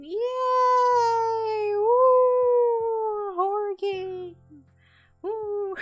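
high, loud sarcastic whooping; hammed-up yay; mock cheer. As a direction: fake excitement for torture. Sarcastic fake cheer for horror.

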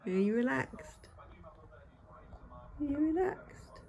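soft unvoiced whisper. Real, not acted. Owner whispering to a relaxed cat, hushed whisper. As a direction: whisper so as not to disturb the pet.

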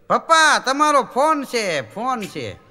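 put-on high, loud, slow child's call; child ringtone voice. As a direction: mimic a kid's ringtone calling dad. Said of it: "ringtone અલગ અલગ હોય". (બાળકના અવાજમાં ઊંચું, ધીમું "પપ્પા")

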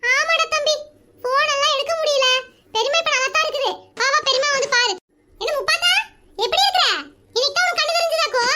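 lightly voiced sister, needling her brother; older sister voice. Sister voice again.

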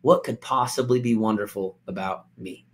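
defeated, quiet self-pity; self-doubting. Shown as a demonstration. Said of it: "Do you hear the difference in my tone of voice".

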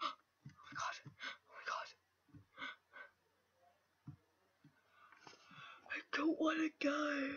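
frightened whispers, barely voiced; scared whisper. Real, not acted. Player frightened of being knocked off, whispering scared. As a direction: hold your breath and whisper in fear. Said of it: "oh my god, I'm scared".

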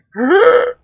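sharp high gasp for a fake hiccup; forced gasp. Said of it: "force it out, or pretend to".

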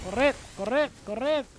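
high, urgent cries to run; urgent calling. Real, not acted. Player hurries teammates who may not make it, urgent high call. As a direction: call out high, hurrying everyone. Urging team to outrun storm.

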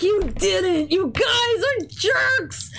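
high, whiny mock cry, slow on jerks; pretend-wail at the pranking students; mock outrage. Teacher mock-wails at students who pranked the sub.

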